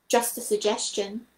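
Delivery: ordinary delivery, nothing marked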